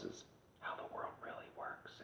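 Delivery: confiding whisper of a hard truth. Real, not acted. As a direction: drop to a whisper as if sharing a secret. Revealing how the world works.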